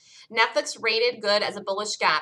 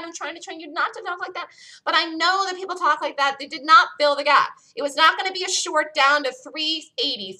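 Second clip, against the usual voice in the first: higher, louder, fed-up scolding; exasperated rant; 7 semitones higher, 4 dB louder than usual. Real, not acted. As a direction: scold the class for sloppy talk, pitch up. Scolding students about gap talk.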